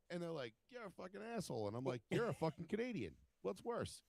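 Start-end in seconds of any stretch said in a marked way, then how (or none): 0.7-1.7 higher, softer imitated heckler; re-enacted jeer without shouting; retold insult. 2.1-4.0 high-pitched mock insult; animated quoted retort, no shouting; retold comeback.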